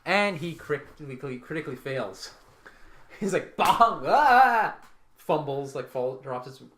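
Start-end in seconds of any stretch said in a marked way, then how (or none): 3.6-4.8 loud, high comic "bong" and yelp; big mock impact and scream; fumbled-blow noise and cry. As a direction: yell out a botched swing. Acts out a fumbled swing.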